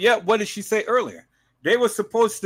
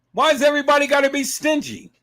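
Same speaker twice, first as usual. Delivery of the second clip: ordinary delivery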